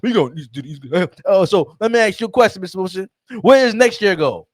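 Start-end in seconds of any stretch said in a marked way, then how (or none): 0.0-0.8 fast, low mocking fan boast; quick lowered impression of a bragging fan; mock Knicks fan. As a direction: rattle it off low like a smug fan. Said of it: "But no".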